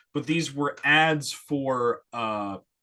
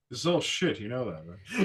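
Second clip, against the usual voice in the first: lightly voiced drunk Welles, lower and softer with a laugh; drunk Orson Welles; 5 semitones lower, 5 dB quieter than usual. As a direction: mumble it like a sloshed old actor. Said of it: "like, drunk".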